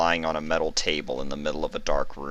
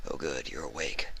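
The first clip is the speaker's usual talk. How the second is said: a much softer, low casual voice; Skolder voice; 3 semitones lower, 12 dB quieter than usual. A narrator's reading. Skolder entering Vinyl's room, casual. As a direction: greet her offhand.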